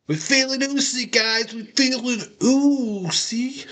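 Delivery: high, slow, put-on chant of the name; Usos' voice. Impersonating the Usos to Sammy, high and drawn out. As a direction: mimic the Usos hyping up Sammy. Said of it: "Usos look at Sammy's proper like".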